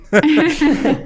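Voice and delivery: high, loud burst of laughter; big laugh. Real, not acted. Host cracking up at her own joke, loud laugh.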